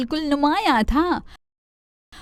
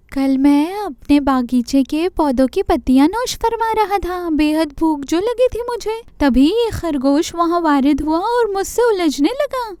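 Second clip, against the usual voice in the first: much higher pleading deer voice; complaining deer; 5 semitones higher than usual. Acted. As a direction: tattle to Chanda Mama. Deer complains to Chanda Mama.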